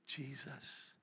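very soft, low single word; almost under the breath, low; hushed answer. Real, not acted. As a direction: give the answer very quietly. Answers his own unanswered question.

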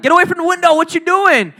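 scolding parent. Retold or imitated. Parent voiced scolding nosy kid.

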